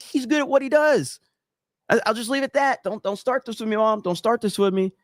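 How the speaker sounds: acted-out bickering with a parent; retold defensive insistence. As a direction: hold your ground against mom's prodding. Acting out arguing back at mom.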